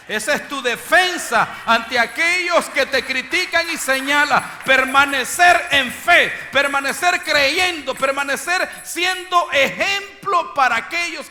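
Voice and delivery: loud, high preacher's cry; preaching at full force. Acted. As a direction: preach it out to the church. (predicando a todo pulmón)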